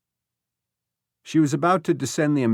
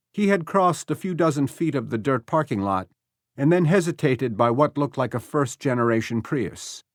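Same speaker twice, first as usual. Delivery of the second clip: plain reading voice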